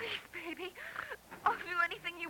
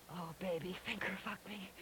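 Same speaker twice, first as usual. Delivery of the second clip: breathless whisper, lower and softer; whispered plea; 10 semitones lower, 6 dB quieter than usual. Acted. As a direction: beg in a hushed whisper. Urgent plea during acted sex.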